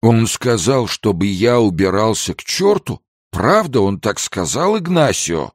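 put-on high-pitched, offended drunken questioning; indignant. A narrator's reading. Drunk Pedro checks the insult with Ignacio, indignant. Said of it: "возмущался один, а другой".

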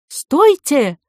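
high, loud shout to halt; calling after. A narrator's reading. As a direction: yell after people running away. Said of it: "преследовал их голос портье". (громкий окрик вдогонку)

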